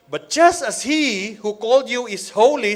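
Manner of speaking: loud, high preaching of scripture; preaching at full force. Acted. Preacher proclaiming the anchor verse to the congregation.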